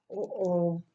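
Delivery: ordinary delivery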